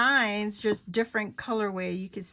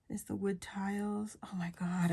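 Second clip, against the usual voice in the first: hushed delighted whisper; 6 dB quieter than usual. Real, not acted. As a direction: breathe it out in awe. Whispered admiration of paper.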